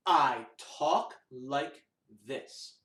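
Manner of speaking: slow, low spoken words, spaced apart; talking voice. Shown as a demonstration. Teacher demonstrating talking voice to children, slow and spoken. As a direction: say it deliberately, word by word. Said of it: "say that with me".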